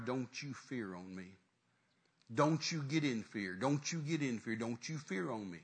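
low, soft and firm; hushed, steady command not to fear; intent reassurance. Acted. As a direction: speak privately and earnestly to one frightened man. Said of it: "looked him right in the eye and said".

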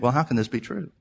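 soft, low, halting voice of doubt; small, hesitant voice trailing into pauses; self-doubting believer. Acted. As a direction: falter quietly, as if unable to believe it. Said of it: "They think".

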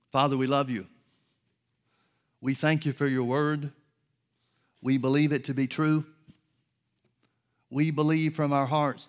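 earnest praying aloud to God; fervent prayer. Acted. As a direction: pray it with conviction. Prayer after sermon.

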